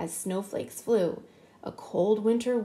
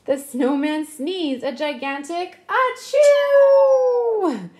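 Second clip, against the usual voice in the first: big sneeze; 6 semitones higher, 7 dB louder, slower than usual. A narrator's reading. Sneeze in read-aloud.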